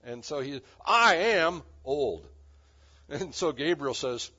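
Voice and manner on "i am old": put-on "I am" slow and very loud, pause before "old"; emphatic I AM. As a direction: stretch and hammer "I am". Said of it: "Zechariah said with emphasis".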